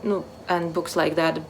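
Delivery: ordinary talk, nothing marked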